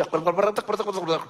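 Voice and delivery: a foreigner's gibberish, mimicked with a laugh; mock foreign gibberish. Dutch guest mimicking Indonesian talk, laughing gibberish. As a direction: parrot made-up words the way a guest mimics Indonesian. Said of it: "niru-niru Indonesia".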